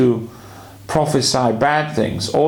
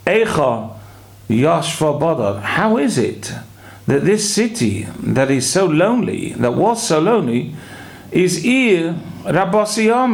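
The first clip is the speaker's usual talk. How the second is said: higher-pitched, triumphant lift on "full of people"; re-emphasized reading; 6 semitones higher than usual. Shown as a demonstration. Commentators reread the lament as redemption, emphatic and uplifted. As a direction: pause after "Yoshva Bodad", then lift "How is it" high. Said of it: "reread it a little bit differently".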